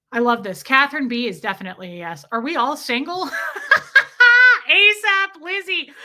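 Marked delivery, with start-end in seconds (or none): 3.3-4.6 high, loud burst of laughter; big high-pitched cackle. Real, not acted. Laughs at question about being single. 4.7-5.9 laughing. Real, not acted. Still laughing at the single question.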